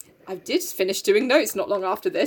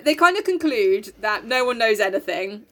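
ordinary talk

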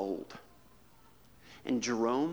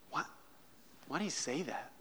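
ordinary talk, nothing marked

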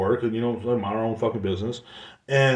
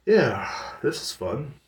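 sarcastic; 3 semitones higher, much slower than usual. Retold or imitated. Splashed while washing dishes.

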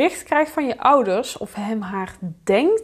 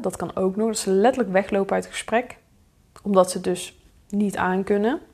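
usual speaking voice

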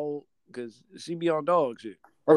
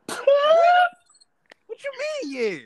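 very high, loud squeal dropping in volume; comic retold falsetto shock; shocked disbelief; 7 dB louder, slower than usual. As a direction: shriek the first word, then squeak the question. Retold shock at her admission.

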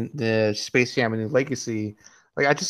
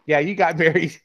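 laughing; 3 semitones higher, 4 dB louder than usual. Real, not acted. Teasing friend about wedding.

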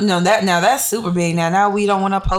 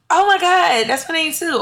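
mock excited gush; 9 semitones higher than usual. Retelling strangers who share her name, mock excited. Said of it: "it's like".

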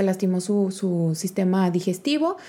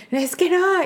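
whiny protest; 10 semitones higher, slower than usual. Retold or imitated. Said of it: "retold crying argument".

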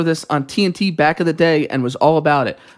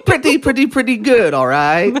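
higher-pitched director impression with a questioning tag; Quentin Tarantino; 5 semitones higher than usual. Shown as a demonstration. Trying the voice for laughing co-hosts, loose director impression. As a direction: push it up into a loose celebrity impression. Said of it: "Can you say it in Quentin Tarantino's voice?".

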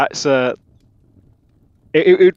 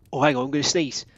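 usual speaking voice

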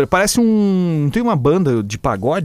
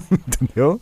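ordinary delivery

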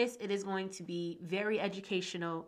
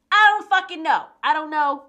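higher, much louder exasperated blurt; frustrated outburst; 7 semitones higher, 12 dB louder than usual. Real, not acted. Host fed up with noisy neighbors, loud exasperated outburst. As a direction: let the frustration burst out.

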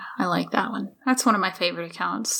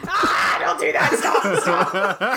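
loud, laughing objection; laughing protest; 2 semitones lower, 4 dB louder, much slower than usual. Real, not acted. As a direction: yell through laughter.

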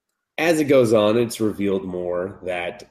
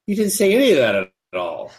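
retold protest; 8 semitones higher, 3 dB louder than usual. Boy retorting in anime recap.

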